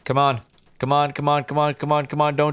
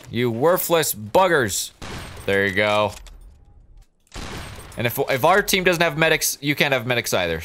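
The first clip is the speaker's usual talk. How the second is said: ordinary talk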